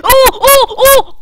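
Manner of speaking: high, loud bursts of oh; excited yelps. Real, not acted. Player watching someone nearly kill Touch, excited yelps. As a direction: yelp each one, high and sudden. Sudden danger in game.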